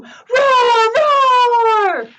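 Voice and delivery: high, loud, long play roars; big storybook dinosaur roar. Acted. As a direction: drown out the cow with roaring.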